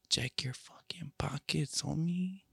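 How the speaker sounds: hushed menacing whisper; whispered threat. Pretend. Whispered threat ending the promo.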